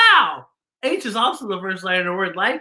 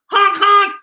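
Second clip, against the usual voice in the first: car horn; 12 semitones higher, 7 dB louder than usual. Pretend. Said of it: "dressed as a car".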